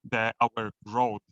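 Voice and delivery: ordinary talk, nothing marked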